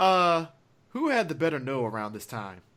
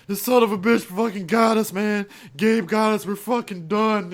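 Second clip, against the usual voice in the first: louder, higher, panicked; panicking; 7 semitones higher, 6 dB louder than usual. Retold or imitated. Rex to Quaylen, panicking and loud. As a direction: freak out, all is lost. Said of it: "getting all fucking crazy".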